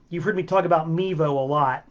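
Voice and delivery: usual speaking voice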